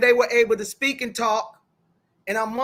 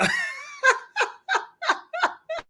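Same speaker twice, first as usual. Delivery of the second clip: very high-pitched laughter; real laugh; 3 dB quieter than usual. Real, not acted. Host amused at not knowing him either, laughing. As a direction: burst into a long, high laugh.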